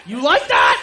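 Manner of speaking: high, loud acted shout; aggressive taunt. Woman who just slapped her partner, shouting tauntingly. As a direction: yell it right after the slap. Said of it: "She's riding you cowgirl and just whack".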